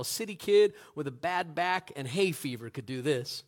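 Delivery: ordinary talk, nothing marked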